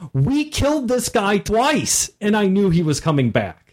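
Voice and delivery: player's exclamation. Retold or imitated. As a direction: insist it with total conviction. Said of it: "one of the players exclaimed".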